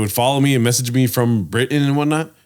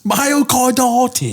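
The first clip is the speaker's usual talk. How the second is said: slow high British drawl; British accent; 12 semitones higher, much slower than usual. Retold or imitated. Mocking British voice.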